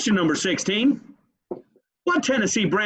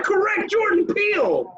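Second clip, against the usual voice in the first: higher, shouted announcement; excited shout. Real, not acted. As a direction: call out the winner. Announcing correct answer.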